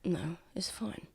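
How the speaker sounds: very soft, breathy answer; whispered brush-off. Acted. Injured Lucian hides his pain from Tav, whispered.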